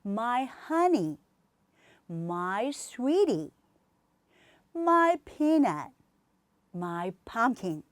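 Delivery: each pair slowly, my as one syllable; my honey, my sweetie, slow drill; slow endearments. Shown as a demonstration. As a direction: croon each pet name slowly. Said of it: "my 하셔서".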